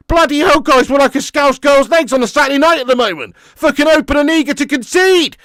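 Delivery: loud, high furious ranting; angry rant. Real, not acted. Fan raging to chat about the defence, shouting. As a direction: roar it in outraged disbelief.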